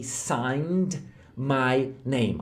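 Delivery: drawn-out, careful English words; very slow model phrase. Shown as a demonstration. Teacher drawing out the phrase for students. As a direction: stretch each word out. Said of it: "e assinei".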